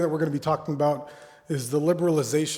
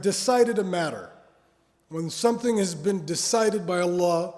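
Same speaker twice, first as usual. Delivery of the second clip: louder, higher emphatic preaching; 3 semitones higher, 4 dB louder than usual. Acted. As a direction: drive the point home. Preaching at rising force.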